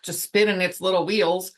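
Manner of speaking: ordinary delivery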